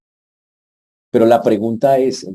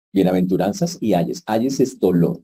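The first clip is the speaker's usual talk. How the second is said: ordinary talk, nothing marked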